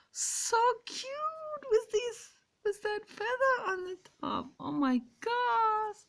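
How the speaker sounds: cutesy squealing. Real, not acted. Woman gushing over a bird's head feather, squealing. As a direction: squeak with delight over something adorable.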